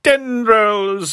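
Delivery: high, drawn-out mock cry; mocking echo. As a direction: echo the word back in a silly high voice. Mockingly echoes the reader's word.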